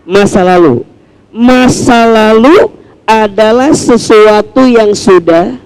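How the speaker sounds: ordinary talk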